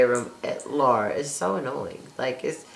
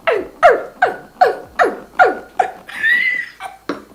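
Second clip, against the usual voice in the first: high yappy mock barks; puppy barking; 4 dB louder than usual. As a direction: bark like a little puppy dog, high and loud. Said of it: "kept sounding like a puppy dog".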